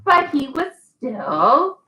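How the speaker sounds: slow, drawn-out storybook reading; patient read-aloud for a toddler; reading to a child. Acted. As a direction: leave pauses for the child to fill in. Reads The Very Hungry Caterpillar.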